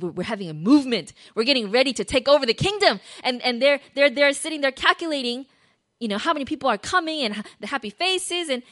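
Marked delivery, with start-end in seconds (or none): 0.0-3.0 loud, rallying disciples; getting excited. Acted. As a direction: voice the crowd's building excitement, much louder. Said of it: "And they're getting excited".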